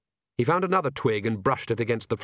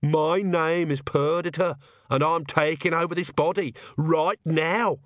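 different commanding voice; 6 semitones higher, slower than usual. Acted. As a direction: announce it slowly like someone else inside. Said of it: "her voice sounded quite different".

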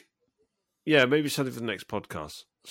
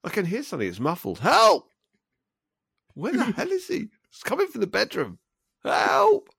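high-pitched cries for help; high calls for help; 9 semitones higher than usual. Pretend. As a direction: cry out for help, trapped. Trapped in the duvet.

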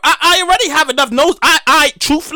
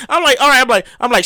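ordinary talk, nothing marked

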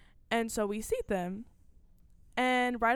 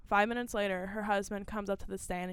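ordinary talk

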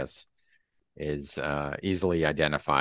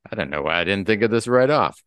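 giant alien booming voice; 3 semitones higher, 7 dB louder than usual. Acted. Ken voiced scheming, big boast.

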